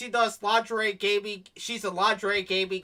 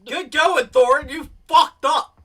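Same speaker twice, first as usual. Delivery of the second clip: high, shouted jeer; loud, mocking shout; loud sarcastic congratulation; 8 semitones higher, 13 dB louder than usual. Real, not acted. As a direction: call him out loudly, stretching the ending. Mock congratulating the absent Thorin.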